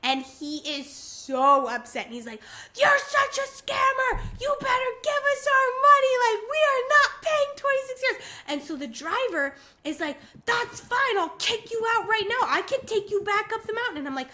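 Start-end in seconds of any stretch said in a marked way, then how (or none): 2.8-8.3 high, loud retold yelling; screaming match. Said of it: "it turns into a full-on screaming match". 10.5-14.0 high-pitched retold shouting; screaming back. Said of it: "a full-on screaming match in the car".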